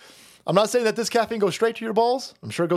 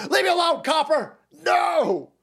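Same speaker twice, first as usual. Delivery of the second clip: high hammed-up yell; mocking the driver's high yell; mock scream; 7 semitones higher than usual. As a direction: shriek like the fleeing driver.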